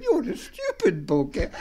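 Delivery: slow, laughing through the insult. Retold or imitated. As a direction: giggle out the parrot's words. Parrot line repeated, laughing.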